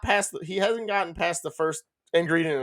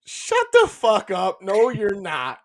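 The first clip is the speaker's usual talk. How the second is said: drawn-out, higher "come off it" retort; retold disbelief; 4 semitones higher, much slower than usual. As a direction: call out a tall tale, stretching the opening. Retelling his disbelief at a boast.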